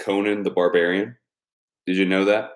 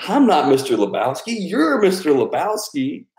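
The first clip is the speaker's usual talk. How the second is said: movie quote; 7 semitones higher than usual. Retold or imitated. Quoting Big Lebowski lines.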